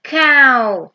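cow said loud and clear as a teaching model; teacher modelling the word cow for children; model pronunciation. Shown as a demonstration. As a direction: call out cow slowly and brightly for kids to repeat. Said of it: "Trong tiếng Anh đọc là".

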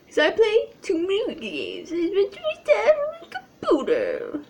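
high, hammed-up whiny sobbing; mock wail. Confessing too much gaming to viewers, mock wailing. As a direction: wail it like a fake cry.

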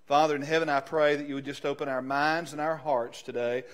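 ordinary delivery

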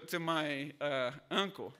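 ordinary talk

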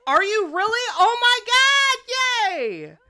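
high, loud, very slow overjoyed cry; thrilled cry. Real, not acted. Streamer overjoyed at the pregnancy reveal, thrilled cry. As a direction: squeal with delight at the news.

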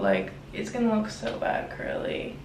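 normal talking voice

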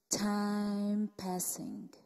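very slow, soft, low refrain; drawn-out refrain. Acted. Poet intoning the time refrain, slowly and softly. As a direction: stretch each word out quietly.

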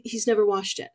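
ordinary delivery

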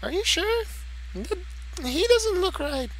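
very high squeaky sidekick; squeaky cartoon voice. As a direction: pitch way up like a cartoon kid. High character voice.

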